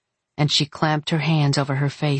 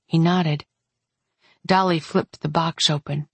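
usual speaking voice

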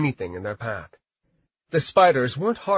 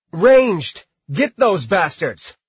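loud, high battle yell; 4 semitones higher, 6 dB louder, slower than usual. A narrator's reading. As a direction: roar the order at the archers. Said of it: "Dwayne yelled out".

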